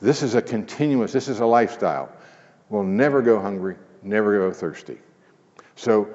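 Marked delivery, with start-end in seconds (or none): none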